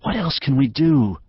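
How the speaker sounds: high, constricted plea; anguished question, pitched high; tight-throated despair. A narrator's reading. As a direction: plead helplessly through a tight throat. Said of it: "Her throat constricted".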